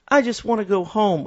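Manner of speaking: put-on high, plaintive little girl; high girlish voice. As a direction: whimper it like a homesick girl. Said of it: "And she says".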